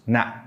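"na" cut off by a glottal stop; casual glottal-stop ending. Shown as a demonstration. Teacher showing casual speech, "na" stopped short in the throat. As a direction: end "na" abruptly, no air released. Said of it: "You just end it with the glottal stop".

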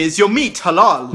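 normal talking voice

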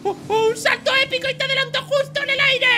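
high, loud thrilled cry; exhilarated shout. Acted. Racer overtakes rival in midair, exhilarated shouting. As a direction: build excitement through the jump.

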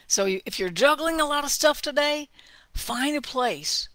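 ordinary talk, nothing marked

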